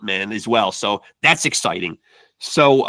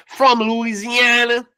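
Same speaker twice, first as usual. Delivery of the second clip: high, drawn-out bad Louisiana accent; botched Louisiana accent; 11 semitones higher, slower than usual. Host signing off on boxer guest, botched Louisiana accent. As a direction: attempt a stretched-out Creole Louisiana twang, badly. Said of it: "I didn't do that accent right at all".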